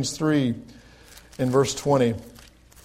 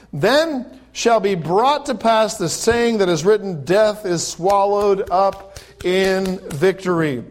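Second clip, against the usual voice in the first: a slightly raised, slow reading of scripture; preacher reading scripture, a bit higher; 5 semitones higher, 4 dB louder, slower than usual. Acted. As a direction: proclaim the verses with rising fervour. Reads scripture at raised pitch.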